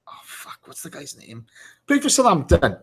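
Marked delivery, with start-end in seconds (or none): 0.1-1.5 whispered, much softer curse; a hushed curse while trying to remember a name; muttered aside. Real, not acted. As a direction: mutter it to yourself under your breath.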